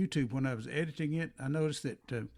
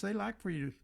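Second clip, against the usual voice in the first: ordinary delivery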